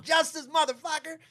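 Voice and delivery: high-pitched gloating yell; gloating cheer. Retold or imitated. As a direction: crow it in mock triumph. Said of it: "laughing our asses off".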